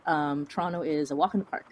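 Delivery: normal talking voice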